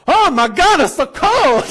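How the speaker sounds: high, very loud exclamation of shock; mock shriek. Woman bursting in complains about the cold, mock shriek.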